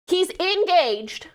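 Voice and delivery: slow, high stress on "engaged"; emphatic outrage. Real, not acted. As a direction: stretch "engaged" out in disbelief. Hammering home the scandal.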